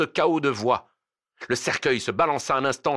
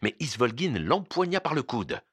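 plain reading voice

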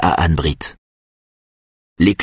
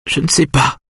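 plain reading voice

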